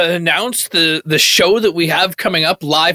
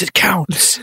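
whispering. Acted. Whispered argument with bouncer.

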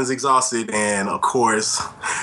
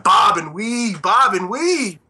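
ringside cheer; 9 semitones higher, 3 dB louder, slower than usual. Retold or imitated. Cheering on a fight.

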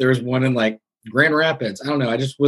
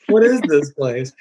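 bewildered; 3 semitones higher than usual. Retold or imitated. Imitating dad and self bewildered.

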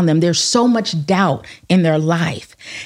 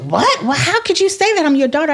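put-on higher, fast, wounded teen protest; imitating outraged daughter; 9 semitones higher, faster than usual. Teen daughter hurt by her mother's honesty, mock outrage. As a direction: lightly play the shocked daughter. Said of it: "same reaction".

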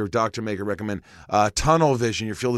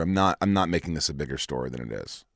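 normal talking voice

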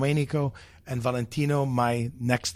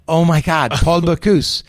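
louder, in sudden amazement; awed exclamation; 4 semitones higher, 8 dB louder, slower than usual. Retold or imitated. Young cook realizing he'll cook for Bocuse, awed and loud. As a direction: blurt it out, star-struck.

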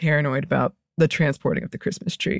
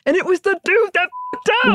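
much higher, exasperated blame; indignant outburst; 12 semitones higher than usual. Real, not acted. Storyteller blaming the dude at the farm, indignant outburst. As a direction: burst out in high-pitched indignation.

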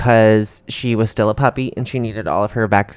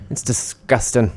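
slow, put-on Irish accent; 6 dB quieter, slower than usual. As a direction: lame Irish impression, drawn out. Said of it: "What is that accent that you're doing".

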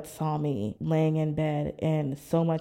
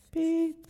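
machine beep imitation; 12 semitones higher than usual. Imitating the blood pressure machine.

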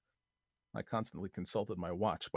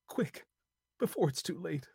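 hopeless resignation; 9 semitones higher than usual. A narrator's reading. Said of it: "a softer quality, as of hopeless resignation".

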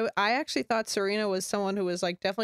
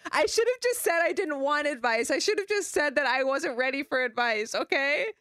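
mock sobbing; 6 semitones higher than usual. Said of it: "I'm sobbing on the floor".

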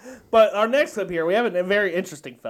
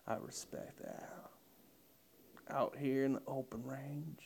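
Matthew McConaughey; 8 semitones lower, 9 dB quieter than usual. Acted. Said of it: "I'd impersonate him doing his Lincoln commercials".

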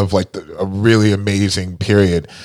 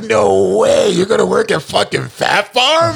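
friends mocking; 12 semitones higher than usual. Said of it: "They're like".

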